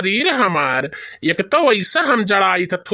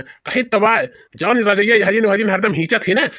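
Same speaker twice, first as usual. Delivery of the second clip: usual speaking voice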